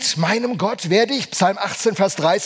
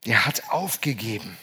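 much lower and softer, heavy and resigned; 8 semitones lower, 6 dB quieter than usual. Acted. Pastor to congregation, about someone without goals: low, resigned hush. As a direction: let it sink, as if he gave up.

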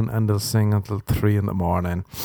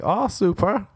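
sarcastic; 10 semitones higher than usual. Retold or imitated. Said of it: "You're like".

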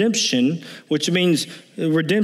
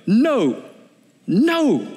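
mild mock refusal, second "no" higher; lightly voiced indignant "No, no"; double no; 4 semitones higher than usual. Preacher imitating Paul's emphatic double negative, mildly raised. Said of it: "a double negative where he'd go".

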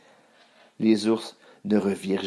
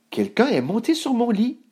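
much higher, feminine mother-bear voice; Maman Bear; 12 semitones higher, 3 dB louder than usual. A narrator's reading. Maman Ours adding after Papa, high astonished voice. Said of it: "ajouta Maman Ours".

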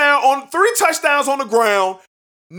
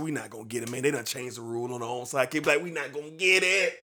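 dejected; 10 semitones lower, 13 dB quieter, faster than usual. Retold or imitated. Retold gloomy resignation.

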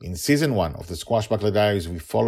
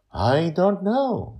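concerned; 12 semitones higher, 4 dB louder, much slower than usual. A narrator's reading. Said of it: "he says, concerned".

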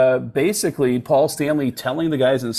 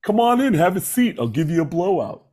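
pitched-up hair salon host impression; 6 semitones higher than usual. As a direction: usher a client in with a put-on, higher voice. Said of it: "The [name] hair salon".

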